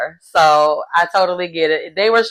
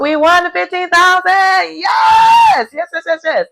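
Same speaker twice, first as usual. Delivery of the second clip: much higher, shouted yes of victory; triumphant cheer; 11 semitones higher, 4 dB louder than usual. Real, not acted. Vlogger celebrates the team winning fifteen thousand, ecstatic shout. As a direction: cheer like you just won money.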